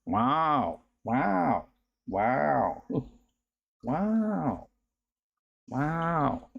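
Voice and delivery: repeated drawn-out "wow" catchphrase; Owen Wilson. Retold or imitated. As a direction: imitate an actor's stretched, arching wow. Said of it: "all the times he said".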